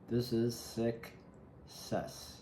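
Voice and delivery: very slow, stretching every word of "This is success."; slow dramatic pronouncement; drawn-out success. Real, not acted. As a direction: savour it, dragging "success" out long. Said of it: "I don't know why I said it like that".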